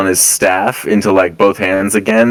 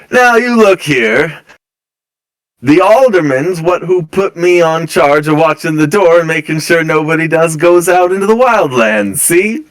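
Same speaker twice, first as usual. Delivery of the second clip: a stubborn guard planting his feet; gruff guard; 7 semitones higher than usual. Acted. Guard warning the noble party off the door, gruff and higher. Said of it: "and he goes".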